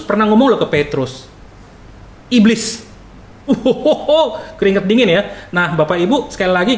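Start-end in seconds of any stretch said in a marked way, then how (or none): 2.3-2.8 higher-pitched one-word rebuke; sharp rebuke. Acted. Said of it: "bisa berkatanya pedes". 3.5-4.6 high, drawn-out "uhuhu"; playful shudder at a harsh word; mock shuddering hoot. Mock shudder after harsh rebuke.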